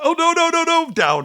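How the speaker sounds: high, loud mock panic; loud high mock panic of refusal; panicked no. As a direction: squeal it like a scared teenager. Teens panicking on the phone.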